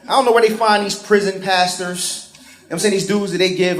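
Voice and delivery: ordinary talk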